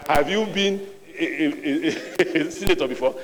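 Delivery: a real laugh breaking the quote; laughing through it. Real, not acted. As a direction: laugh as you retell his jab. Laughing while retelling insult.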